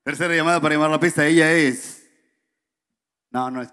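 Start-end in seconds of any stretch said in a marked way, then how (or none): 0.0-2.0 announcer voice. Pretend. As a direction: announce the dancer like an MC. MC announcing dancer at table.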